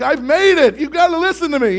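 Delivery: high, loud, desperate; frantic plea. Retold or imitated. Youth pastor begs the possessed girl, frantic loud plea. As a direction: plead at the top of your voice.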